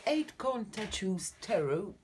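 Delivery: a self-mocking stab at an English accent on the deck name. Real, not acted. Tarot reader to viewers, naming the English deck in a shaky English accent. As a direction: say the title in a clumsy English accent. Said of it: "pour mon superbe accent anglais".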